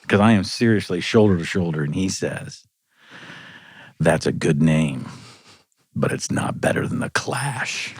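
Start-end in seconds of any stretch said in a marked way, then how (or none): 4.0-8.0 slow, low, measured imitation of a musician; retold slow verdict, trailing into near-whisper; slow British delivery. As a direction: drawl it out, dropping to a hushed finish. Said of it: "in this slow British accent".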